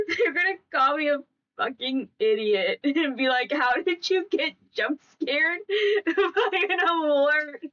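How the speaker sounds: talking through giggles, pitch lifting toward the end; laughing. Real, not acted. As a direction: laugh while imagining their teasing. Laughing as she predicts family's teasing.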